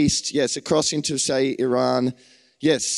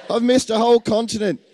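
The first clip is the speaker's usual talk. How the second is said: sudden dismay; 8 semitones higher, 4 dB louder, faster than usual. Real, not acted. Preacher realises he forgot Africa.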